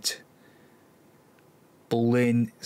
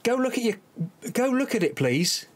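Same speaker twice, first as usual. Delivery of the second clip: pleading with referee; 11 semitones higher than usual. Real, not acted.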